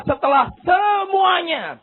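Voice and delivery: "semuanya" drawn out and called out high; slow emphatic proclamation. Shown as a demonstration. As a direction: stretch and proclaim "setelah semuanya". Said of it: "Alkitab berkata".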